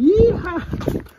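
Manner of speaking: piercing high cowboy whoop; shrieking jackpot winner. Real, not acted. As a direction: let out a wild yeehaw. Jumps for joy at find.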